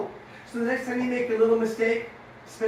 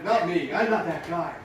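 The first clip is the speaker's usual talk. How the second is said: ordinary delivery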